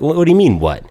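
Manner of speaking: imitating stunned disbelief; shocked. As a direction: jump up in surprise. Son shocked at dad's news.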